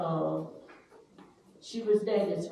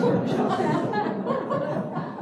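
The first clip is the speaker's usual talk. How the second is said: high burst of laughter; 12 semitones higher, 5 dB louder than usual. Real, not acted. Joke about dating your sibling.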